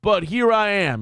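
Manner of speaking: high, loud mock proclamation; imitating the speaker. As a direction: announce yourself like a self-important comeback. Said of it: "He's like".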